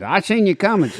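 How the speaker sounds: lightly voiced officer; mock cop voice. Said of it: "the cop said".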